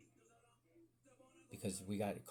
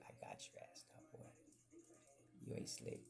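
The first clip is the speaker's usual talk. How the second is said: hushed principal threat; 13 dB quieter than usual. Retold or imitated.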